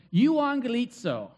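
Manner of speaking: light Italian lilt on the word; a touch of Italian accent. Shown as a demonstration. Preacher introducing a Greek word to the congregation, slight Italian accent. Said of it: "do a little Italian accent".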